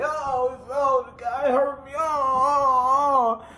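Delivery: high, hammed-up whimpering of a faked hurt player; sing-song fake pain moans, way up high; mock injured whining. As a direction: wail like you're faking a foul injury. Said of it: "that fake shit".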